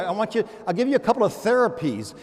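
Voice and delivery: ordinary delivery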